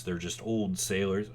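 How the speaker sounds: ordinary delivery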